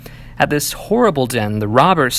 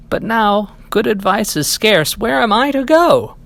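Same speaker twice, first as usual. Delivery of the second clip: plaintive cat; 8 semitones higher than usual. Acted. Cat asks where to go.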